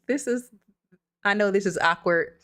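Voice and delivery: ordinary talk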